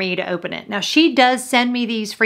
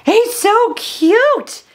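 pitched-up gushing over the dog; cooing at the dog; 11 semitones higher, 4 dB louder, much slower than usual. Real, not acted. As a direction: coo it to the dog lying next to you. Cooing over her dog Scooby.

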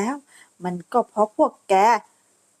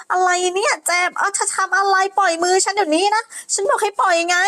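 angry demand; 6 semitones higher than usual. Acted. Lady grabbed demands release.

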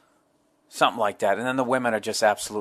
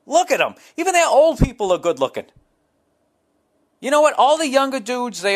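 higher and louder, marvelling at every passer-by; awestruck gushing; 12 semitones higher, 4 dB louder than usual. Retold or imitated. To his girlfriend on the street, raving in wide-eyed awe. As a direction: gush like you can't believe your eyes.

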